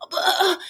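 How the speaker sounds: high, exasperated "ugh"; lightly hammed-up exasperated ugh; mock disgusted groan. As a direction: groan like a colleague who won't budge. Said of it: "he's like".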